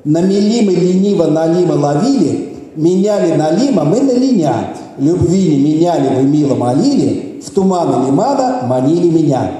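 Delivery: unhurried, lilting demonstration; slow tongue twister. Shown as a demonstration. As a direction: say it slowly, phrase by phrase. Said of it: "I'm going to say it slowly first".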